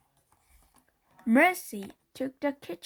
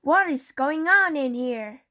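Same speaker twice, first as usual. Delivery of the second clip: 1 demanding; 3 semitones higher, 7 dB louder than usual. A narrator's reading. Sister bursts into room.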